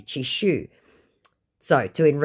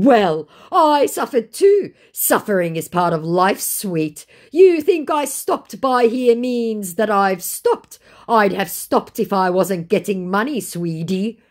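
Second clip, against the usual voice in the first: higher, slow, smug put-on voice; hammed-up smug scammer; mock scammer; 6 semitones higher, 5 dB louder, slower than usual. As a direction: sneer it like the scammer. Voicing scammer's message.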